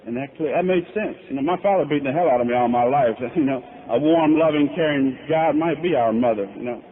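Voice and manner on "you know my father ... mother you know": ordinary talk, nothing marked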